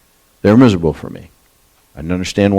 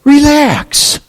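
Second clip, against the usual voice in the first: much higher, tender reassuring plea; Joseph comforting brothers; much slower than usual. Retold or imitated. Joseph reassuring his scared brothers, high gentle comfort. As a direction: soothe your frightened brothers. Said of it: "He says".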